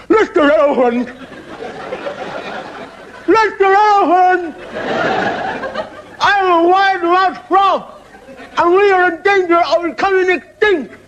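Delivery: very high, slow, stretched frog call; wide-mouthed frog calling. Acted. Frog calling up to the big elephant, very high and slow. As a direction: draw every word out through a huge mouth. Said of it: "he goes".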